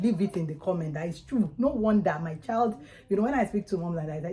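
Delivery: normal talking voice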